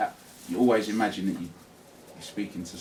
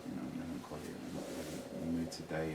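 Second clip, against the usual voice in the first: sad; 3 semitones lower, 13 dB quieter than usual. Shown as a demonstration. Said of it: "if you're sad".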